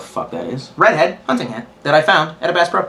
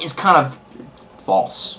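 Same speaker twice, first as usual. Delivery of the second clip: usual speaking voice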